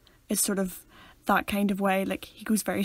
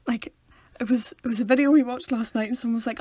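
usual speaking voice

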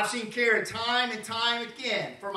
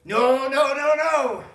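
Peter protesting; 4 semitones higher, 8 dB louder, slower than usual. Retold or imitated. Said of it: "he said".

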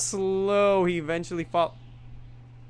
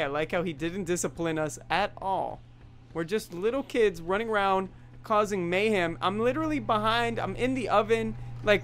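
usual speaking voice

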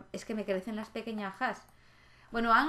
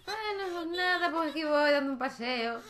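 mock weary stroller; bored cart pusher voice; 6 semitones higher than usual. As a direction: voice the fed-up guy pushing the cart. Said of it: "con cara de".

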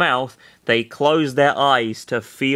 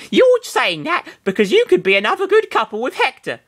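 put-on high girl voice; 12 semitones higher, 3 dB louder than usual. Sarah voiced in read fiction.

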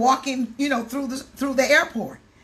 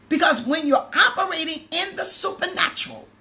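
louder, rising fervent exhortation; preaching at full force; 4 semitones higher, 3 dB louder than usual. Acted. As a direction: preach it out to the whole room.

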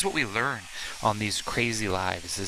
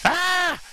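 startled yelp; 8 dB louder than usual. Real, not acted. Reacting to loud dryer noise.

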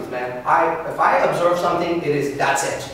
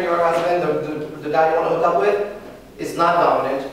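ordinary talk, nothing marked

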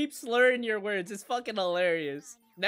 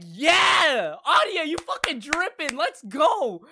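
loud, higher hype cheer; hype shout; 7 semitones higher, 6 dB louder, slower than usual. Retold or imitated. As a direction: cheer her on, hyped. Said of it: "usually I'd be like".

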